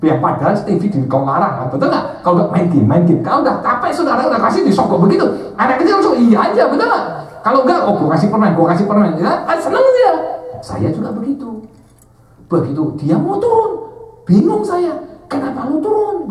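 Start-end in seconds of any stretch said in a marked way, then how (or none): 0.1-10.1 loud, high sermon banter; lively preaching. Acted. 12.5-16.3 loud, high sermon banter; lively preaching. Acted.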